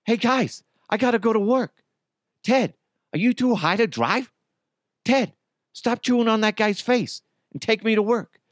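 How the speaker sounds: clumsy high-pitched Wahlberg impression attempt; Mark Wahlberg. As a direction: squeak out a goofy Wahlberg. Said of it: "Can I do a Mark Wahlberg impression".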